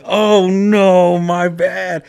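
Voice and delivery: loud, high-pitched mock dismay; exaggerated apology. Apologizing to the woman he farted on, loud mock dismay. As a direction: wail the apology for comic effect. Acts out his embarrassed apology.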